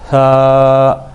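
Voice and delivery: سا as a gentle lisped th, held long; tongue lightly between teeth, stretch سا evenly; soft drawn-out th in سا. Shown as a demonstration. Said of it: "یہ نرمی سے پڑھ جاتا ہے".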